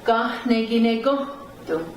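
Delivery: "Gahneginegohtu" with goh stressed, tu falling; confirming "Gahneginegohtu" with the strong goh; upstress on "goh". Shown as a demonstration. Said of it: "up stress goes on the go".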